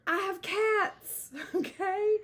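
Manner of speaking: slow, high exasperated retort. Real, not acted. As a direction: drag it out, fed up.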